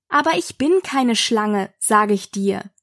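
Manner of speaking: ordinary delivery, nothing marked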